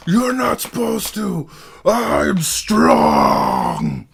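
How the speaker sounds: high loud whining brute; dumb brute voice. Acted. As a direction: whine it like a big dumb giant.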